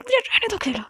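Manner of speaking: insist, voice climbing high. Retold or imitated. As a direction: pitch jumps way up on the repeat. Repeating demand to be left alone.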